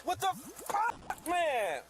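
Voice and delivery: high, drawn-out cry of disbelief; distressed outcry. Real, not acted. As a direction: wail it in real exasperation. Suspect cries out during arrest.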